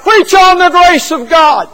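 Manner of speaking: loud call from the back of the church; a man in the pews calls out to the evangelist, loud; hollered out. Retold or imitated. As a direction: holler it out to the preacher. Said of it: "hollered out".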